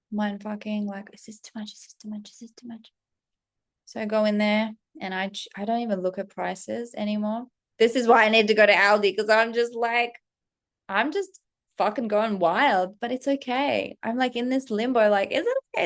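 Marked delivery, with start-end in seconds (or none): 1.1-2.9 anxious murmur. Retold or imitated. Anxious self-questioning. 15.3-15.9 doubtful squeak. Retold or imitated. Doubting limbo question.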